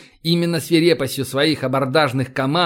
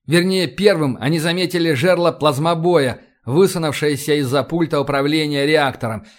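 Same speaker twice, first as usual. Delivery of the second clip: usual speaking voice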